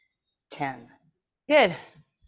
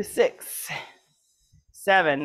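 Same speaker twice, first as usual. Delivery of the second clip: straining with effort; 6 dB louder, much slower than usual. Real, not acted. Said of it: "Oh, my arms are burning".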